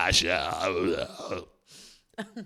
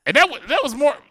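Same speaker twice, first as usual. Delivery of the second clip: loud and high, rising; excited; 11 semitones higher, 9 dB louder than usual. Real, not acted. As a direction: exclaim it loudly.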